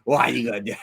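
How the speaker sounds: lightly voiced scolding uncle; a hint of uncle's exasperated questioning; uncle impression. Uncle challenging nephew over wasted money, mock scolding. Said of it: "That's the way my uncle was".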